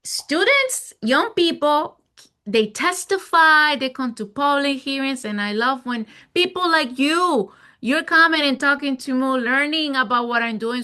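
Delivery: bright, high, eager delivery; excited. Real, not acted. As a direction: pitch lifted high with enthusiasm. Eager answer about young people.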